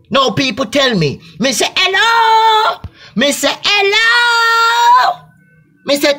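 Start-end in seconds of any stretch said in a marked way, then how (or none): 1.8-2.8 high hello. Acted. Mock greeting called out in sketch. 3.6-5.2 a shrieked, stretched hello, much higher and louder; crying-out hello. Acted. Repeated greeting, louder and stretched.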